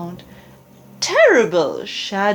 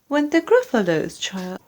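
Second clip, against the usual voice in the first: plain reading voice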